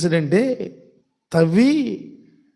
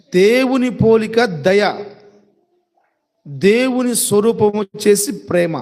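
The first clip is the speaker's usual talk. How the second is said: slow emphatic teaching; slower than usual. Shown as a demonstration. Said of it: "గుర్తుపెట్టుకోండి".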